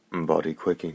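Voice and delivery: usual speaking voice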